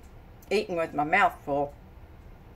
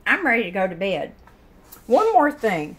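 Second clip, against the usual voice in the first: usual speaking voice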